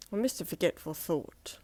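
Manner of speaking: ordinary delivery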